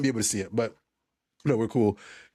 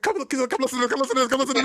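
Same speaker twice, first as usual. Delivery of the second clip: mom calling out excitedly; 13 semitones higher, faster than usual. Retold or imitated. Mom laughing, calling sister over.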